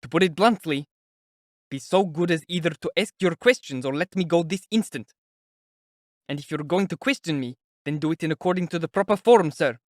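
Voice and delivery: indignant and pushed, just short of shouting; irritated, near shout. A narrator's reading. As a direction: lay down an exasperated ultimatum. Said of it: "he almost shouted".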